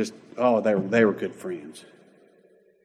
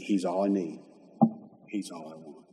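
soft, low confession of faith; hushed devotion; 5 semitones lower, 9 dB quieter than usual. Acted. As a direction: let it sink almost to a murmur. Voicing a believer's faith at communion.

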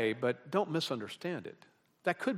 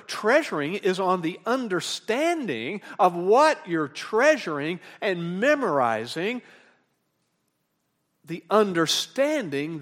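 slow, raised, forceful sermon delivery; emphatic preaching; 6 semitones higher, 9 dB louder, slower than usual. Real, not acted. Preacher telling the congregation what treasuring really means, emphatic preaching. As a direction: lean hard on each phrase, loud and deliberate. Preacher stresses meaning of the verb.

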